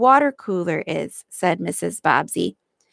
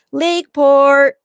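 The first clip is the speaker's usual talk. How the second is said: higher, loud, slow station call; brakeman calling out; 6 semitones higher, 6 dB louder than usual. Retold or imitated. Mother imitates the brakeman's call, loud and drawn out. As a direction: sing out the stop like a conductor. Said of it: "hear the brakeman call out".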